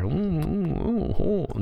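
teasing falsetto oohs, falling each time; a sing-song high "ooh" at the diplomatic spat; mock high oohs. Real, not acted. Reacting to Putin snubbing Tillerson, teasing high oohs.

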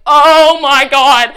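loud, drawn-out mock astonishment; big, slow, dramatised exclamation; retold shock. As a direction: act out stunned disbelief, stretching every word. Retells shock at learning he's brother.

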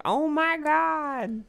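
very high, drawn-out mock shock; mock scandalized. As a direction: imitate a scandalized public, slow and high. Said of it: "people were like".

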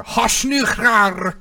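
high, slow, drawn-out mock-Russian shout; imitating the Russian neighbor yelling at her granddaughter. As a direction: bellow fake Russian like the grandma next door. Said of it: "hear her yelling at her granddaughter".

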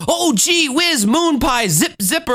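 put-on high, eager kid voice; gosh-golly wholesome kid; gee-whiz old-timey boy. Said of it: "was probably like".

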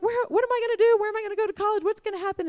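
panicky daughter. Retold or imitated. A daughter panicking about college, imitated frantic voice. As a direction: blurt out worried questions in mock alarm. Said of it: "then I make her panicky".

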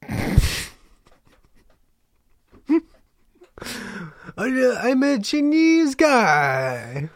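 high, loud, very slow mock Chinese accent; stretched-out, hammed-up Chinese accent. Shown as a demonstration. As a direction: draw out an exaggerated Chinese accent. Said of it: "You know the Chinese accent?".

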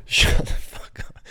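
slow whispered retort. Real, not acted. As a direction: hiss it under your breath slowly. Whispered retort to mock quote.

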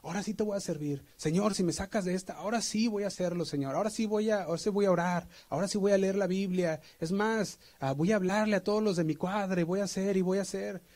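high, pleading and rushed; bargaining with God while hanging on; the boy on the tree pleads with God in a high frantic voice; desperate prayer. Retold or imitated. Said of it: "empezó a orar y decía".

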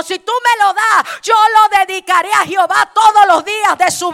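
loud, shouted fiery sermon; preaching at full force. Acted. Preacher to the church about Ana's prayer, shouting. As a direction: thunder it over the congregation.

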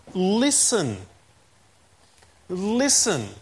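"Listen" as a lone, weighty word; emphatic command. Retold or imitated. Preacher quoting Jesus to the congregation, emphatic command. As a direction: say "Listen" as an emphatic command, then let it hang. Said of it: "it's an emphatic word there".

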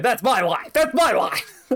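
rising high wrestling promo yell; hammed-up wrestler callback. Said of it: "me and Rita going".

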